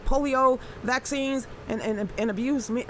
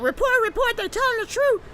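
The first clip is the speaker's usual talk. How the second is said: much higher, alarmed mock voice; mock panicked censor; 11 semitones higher, 3 dB louder than usual. Host imitating someone rushing to report the stream, high mock alarm. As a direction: play a frantic snitch calling it in. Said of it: "somebody's like".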